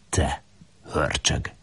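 soft mock scolding. A narrator's reading. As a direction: chide him playfully, quietly. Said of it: "Lajost pirongatja".

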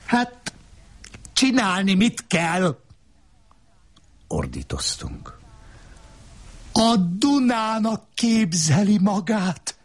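high-pitched scornful woman taunting the man; woman's voice. Acted. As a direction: needle him in a high, mocking voice. Wife's high voice continues.

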